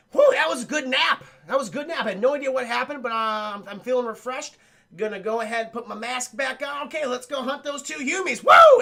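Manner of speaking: a predator stretching after a good nap, then whooping; perky predator. Acted. Streamer voices the predator, high and chipper, ending in a whoop.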